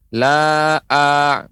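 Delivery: "la a" with the "a" dragged out long; slow demonstration, over-stretched vowel on "a"; wrongly lengthened ain. Shown as a demonstration. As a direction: hold "la a" too long. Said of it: "bukan dipanjangkan".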